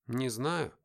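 ordinary delivery

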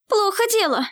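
high-pitched alarmed exclamation; gasped alarm. A narrator's reading. Girl seeing spell about to hit, gasped alarm. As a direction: exclaim it as if gasping, pitch jumping up. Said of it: "ахнула Елеоль".

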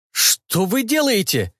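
high horrified «делаете» falling. A narrator's reading. Cyborg horrified as they pull him, high pitched. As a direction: cry «делаете» high in terror. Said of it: "В голосе его послышался ужас".

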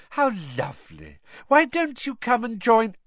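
gushing invitation, rising high and loud; beaming. A narrator's reading. Reporter inviting Harry to join her, beaming and gushing. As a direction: invite him over, all delight.

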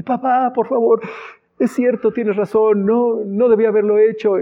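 put-on high, pleading little boy's voice; crying child. Little son pleading with his dad, high and whiny. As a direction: beg like a tearful child caught misbehaving. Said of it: "empieza a llorar desconsoladamente".

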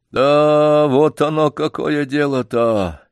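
slow, drawn-out dismayed realisation; lightly voiced old man losing heart; crestfallen. A narrator's reading. Old man discovering what happened to the dog, crestfallen. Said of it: "произнёс старик упавшим голосом".

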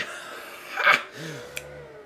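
breathy villain's laugh; evil laugh. A narrator's reading. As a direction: laugh at him cruelly. Villain laughs after threat. (rire diabolique)

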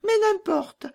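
very high squeaky little bandit; gleefully cruel robber girl. Acted. Robber girl releasing her reindeer, very high and mocking. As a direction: tease and boast in a piercing child voice.

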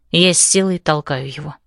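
ordinary delivery, nothing marked

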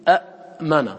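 أأمن broken as a'... mana; original two hamzas. Shown as a demonstration. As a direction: stop hard on the hamza in أأمن. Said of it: "ثم تقول فيه".

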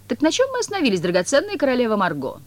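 high, fawning Koroviev; Koroviev's voice. A narrator's reading. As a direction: sweet-talk the queen. (Коровьев, высоко и угодливо)

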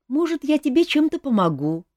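put-on high-pitched, hopeful boy's offer; pleading boy. A narrator's reading. Young prince asking the king to take him along, pleading. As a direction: ask high and eager to be included. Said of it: "попросил принц".

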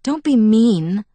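slow, loud grumbling reproach; grumbled. A narrator's reading. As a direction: grumble it crossly, drawn out. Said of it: "I grumbled at her".

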